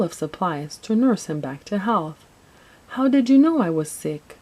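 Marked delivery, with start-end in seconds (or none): 2.9-4.4 higher, louder puzzled question; lightly acted wondering question. A narrator's reading. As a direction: ask it in mild bafflement. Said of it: "a puzzled Santa asked between sniffles".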